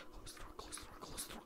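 whispered, hurried pleading; urgent whisper. Acted. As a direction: whisper it so the monster won't hear. Hiding from monster, urgent.

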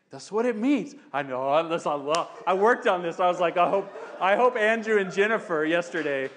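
ordinary talk

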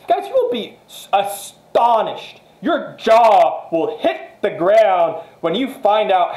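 high and slow, jaw-dropping build-up; excited promise. Acted. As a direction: sell the wonder of it. Promising amazed results.

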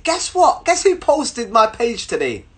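high-pitched, eager news-breaking; excited announcement. Retold or imitated. Bubbly guy telling a girl about his repost, excited. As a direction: start high, settle as the news lands.

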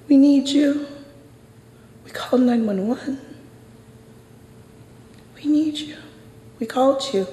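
frightened high-pitched pleading to the officer; blurted plea. Retold or imitated. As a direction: blurt it out in panic. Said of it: "I blurted".

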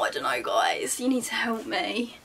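ordinary talk

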